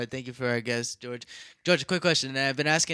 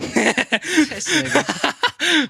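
high laughing exclamation; 11 semitones higher, 6 dB louder, much slower than usual. Real, not acted. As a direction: laugh it out in disbelief. Laughs at friend.

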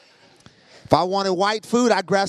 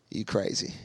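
hushed disbelief; 8 semitones lower, 8 dB quieter than usual. Retold or imitated. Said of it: "I'm like".

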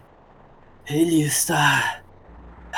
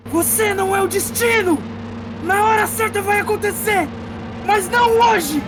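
much higher, loud ringing defiance; defiant hero; 3 dB louder than usual. Acted. Shao shouting down the sword's threats, much higher and loud. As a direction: defy the blade with full force.

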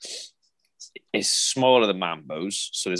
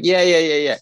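eager yeah; 7 semitones higher, 7 dB louder than usual. Retold or imitated. Woman eagerly offering ketchup.

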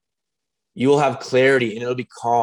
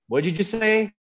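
higher-pitched, mock-incredulous question; pointed questioning; 9 semitones higher than usual. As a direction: act out catching a friend's slip. Acted challenge to friend's admission.

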